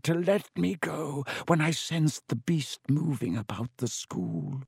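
put-on high, eerie old spider voice; ancient giant spider. A narrator's reading. Spider voice again.

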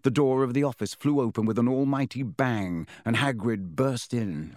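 plain reading voice

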